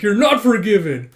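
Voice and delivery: loud, high scolding; mock angry refusal. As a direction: refuse the apology in a play huff. Acted quarrel during battering.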